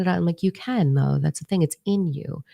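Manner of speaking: ordinary talk, nothing marked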